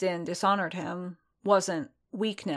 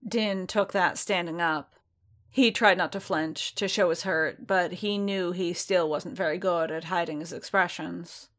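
ordinary delivery

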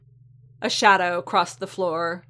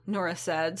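plain reading voice

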